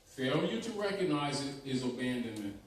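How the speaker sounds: usual speaking voice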